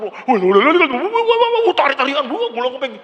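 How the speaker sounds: loud rising wah wah mocking wild shouting; mocking frenzied worshipper. Preacher mocking wild shouting in church, loud and mocking. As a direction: ham up a frenzied charismatic shout. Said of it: "hadirat Tuhan dirasakan tuh".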